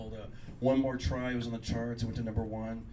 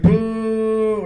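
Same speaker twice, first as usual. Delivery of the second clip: loud, higher mock boo; mock crowd boo; 8 semitones higher, 16 dB louder than usual. Crowd boos the opening comic, loud mock boo. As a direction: boo him like the crowd did.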